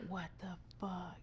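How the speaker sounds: soft, slow stunned mutter; hushed disbelief. Real, not acted. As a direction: breathe it out in disbelief. Listener reacts to being rear-ended.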